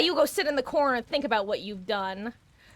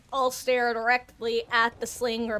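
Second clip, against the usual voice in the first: normal talking voice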